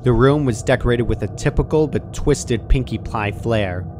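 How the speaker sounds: ordinary delivery, nothing marked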